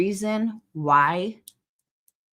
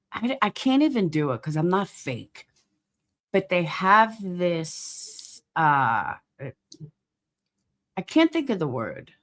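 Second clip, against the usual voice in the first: ordinary delivery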